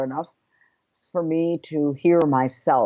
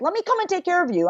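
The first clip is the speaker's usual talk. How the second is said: mother's offer, lifted much higher; a higher, fussing mom voice; imitating mother; 12 semitones higher, faster than usual. Mother offering to care for her grown daughter, imitated higher. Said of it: "My mother to this day is like".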